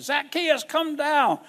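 high loud call up into the tree; Jesus calling up to Zacchaeus, loud and high. Acted. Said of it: "he looked up in the tree and he said".

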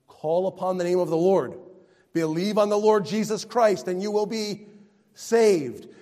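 loud, high, impassioned preacher; full-force preaching. Real, not acted. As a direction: proclaim it to the whole congregation.

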